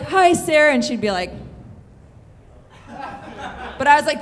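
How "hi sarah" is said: eager hello. Retold or imitated. Eager greeting to her crush.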